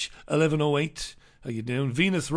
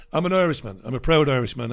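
normal talking voice

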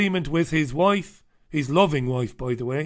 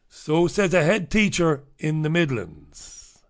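ordinary talk

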